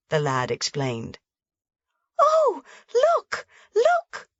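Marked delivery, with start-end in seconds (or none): 2.2-4.3 very high, rising shriek of wonder; wild excited scream. A narrator's reading. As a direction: scream in delight at the sky. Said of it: "Heidi screamed wildly".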